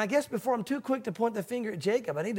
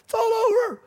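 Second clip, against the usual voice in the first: hammed-up despair; 5 dB louder than usual. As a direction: overplay the doomed sailor giving up. Preacher mocks his own panic.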